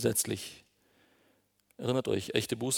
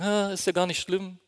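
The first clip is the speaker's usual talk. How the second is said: higher-pitched, pretending all is fine; mock nonchalance; 7 semitones higher than usual. Preacher imitating people who play heile Welt, mock nonchalance. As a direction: brush it off with put-on cheerfulness. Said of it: "heile Welt spielen".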